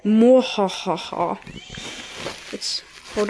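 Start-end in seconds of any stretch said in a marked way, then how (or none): 0.0-1.4 slow, drawn-out evil villain laugh. Acted. Reading the dark lord's lines, an evil laugh. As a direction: cackle like a cartoon dark lord.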